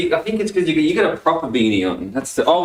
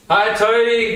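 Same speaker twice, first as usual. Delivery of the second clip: repeated greeting; 7 semitones higher, much slower than usual. Pretend. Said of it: "say, say".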